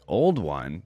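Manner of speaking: a low mock-offended "Old one?"; playfully indignant. Yordle scoffing at the bandit, playfully indignant. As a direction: echo the insult with a grin, half offended. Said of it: "with a playfully indignant grin".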